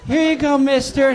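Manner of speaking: put-on high, loud kid handing a cup over; kid's voice. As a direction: play the proud little kid. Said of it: "Just like".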